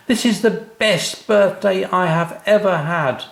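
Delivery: put-on high, loud, overjoyed child voice; a little boy bursting with joy; excited child. A narrator's reading. Sam telling his parents about the shed, overjoyed. Sam thrilled at birthday gift.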